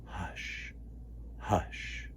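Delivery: soft breathy whisper of hush. Acted. Breeze soothing the leaves, soft whisper. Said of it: "to whisper softly".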